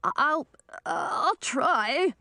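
high, hesitant whimper; cowed, faltering and high-pitched. A narrator's reading. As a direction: stammer it out in a small high voice. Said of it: "Olabald whimpered".

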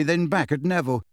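ordinary delivery

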